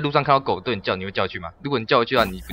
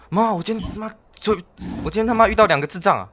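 high-pitched whining to mother; mock complaint to mom; 8 semitones higher than usual. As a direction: put on a high tattling voice. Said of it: "我只会跟我妈讲说".

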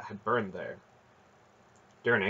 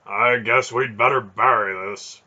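loud, projected character voice; booming-volume dialogue in a put-on voice; Beric's character voice; 10 dB louder than usual. A narrator's reading. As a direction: voice Beric loudly, set apart from the narration. Beric's dialogue in narrated story.

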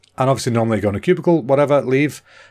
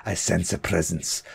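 much lower, much softer, slow eerie murmur of "I sense a presence"; mock spooky medium; 8 semitones lower, 9 dB quieter, slower than usual. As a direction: pretend to be a ghost-hunter sensing a spirit. Mock spooky line about sensing someone.

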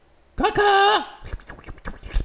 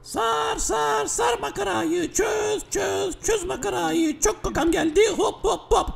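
urgent yelling; 6 semitones higher, slower than usual. Acted. Urgent need for the toilet.

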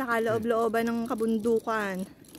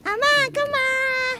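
calling out; 13 semitones higher than usual. Real, not acted. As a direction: call out to dad far away. Calling father from afar. (malakas na tawag kay Ama)

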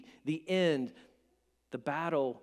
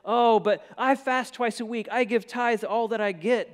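loud, higher self-satisfied boast; boastful Pharisee; 6 semitones higher, 8 dB louder than usual. Retold or imitated. Pharisee praying in the temple, loud boastful voice. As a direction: brag like a smug Pharisee. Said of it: "the Pharisee says".

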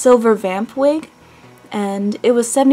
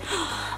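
mock gasp; 15 dB quieter than usual. Mocking a stranger's excited gasp.

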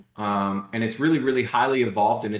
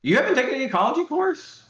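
surprised; 4 dB louder than usual. Real, not acted. Reacting to co-host's answer.

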